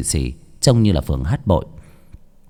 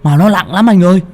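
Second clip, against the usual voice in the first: higher, louder complaint under the weight; straining young man; 6 semitones higher, 4 dB louder than usual. Acted. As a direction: groan about the heavy load. Bảy Ếch straining. (giọng thanh niên cao, than nặng)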